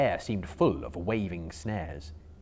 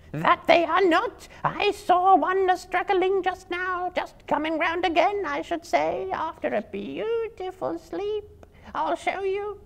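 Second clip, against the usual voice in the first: high, louder, slow spider voice; a spider sure the prey is dead; 5 dB louder than usual. Acted. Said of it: "said the first".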